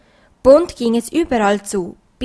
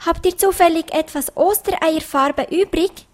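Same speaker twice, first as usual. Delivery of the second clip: lightly voiced, higher-pitched little bunny; a hint of a bunny asking hopefully; questioning bunny; 7 semitones higher than usual. A narrator's reading. Pieps asking meadow animals for egg paint, lightly voiced questioning bunny.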